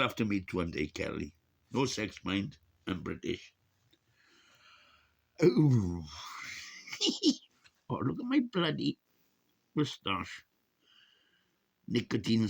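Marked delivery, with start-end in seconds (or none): 5.4-7.4 high, breathy cackle of surprise; squealing laugh. Real, not acted. Vlogger sees his own moustache and squeals with laughter. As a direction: shriek with laughter.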